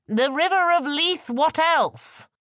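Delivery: high, loud call across the water; hailing shout. Acted. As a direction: bellow it to the other ship as a proud Scot. Shouting to convince the ship.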